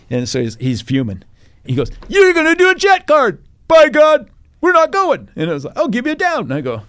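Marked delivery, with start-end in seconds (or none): 2.1-5.3 furious shouting captain, much higher and louder; angry yell. Retold or imitated. Angry captain yelling about jet card. 5.8-6.4 mock threat. Captain threatening, voiced high.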